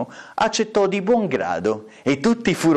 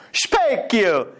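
raised, stretched summons; comic stepmother calling out; imperious stepmother; 7 semitones higher than usual. Acted. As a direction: call out sharply to the mirror. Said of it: "la matrigna interrogò".

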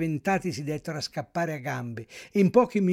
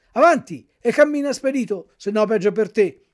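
loud, higher barked military command; soldierly order; 5 semitones higher, 8 dB louder than usual. A narrator's reading. Carabinieri ordering the puppet to march, soldierly command. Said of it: "con accento soldatesco".

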